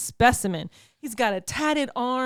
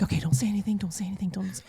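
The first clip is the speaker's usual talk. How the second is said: hushed whisper to herself; 6 dB quieter, much faster than usual. Retold or imitated. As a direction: whisper it under your breath. Telling herself to stay silent.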